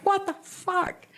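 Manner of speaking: high, drawn-out incredulous question; stunned disbelief. Retold or imitated. Stunned reaction to the confession.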